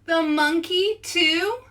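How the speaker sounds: teaching the refrain, high incredulous rise; mock dismay. Shown as a demonstration. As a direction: draw out the question in mock shock. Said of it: "then we say".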